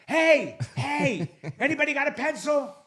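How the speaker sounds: high mock shout to sleeping passengers; hammed-up cabin-wide holler, breaking into a laugh. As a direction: call out high, punching the first hey. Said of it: "All right, I'll try it again".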